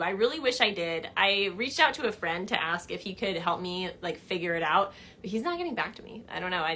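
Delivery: ordinary delivery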